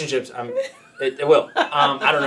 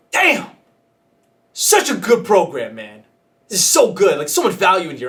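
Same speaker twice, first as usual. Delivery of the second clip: mock delighted; 4 semitones higher, 5 dB louder than usual. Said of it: "I'm like".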